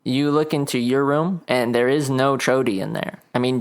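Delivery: usual speaking voice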